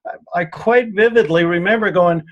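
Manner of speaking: ordinary talk, nothing marked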